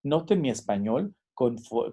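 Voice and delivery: usual speaking voice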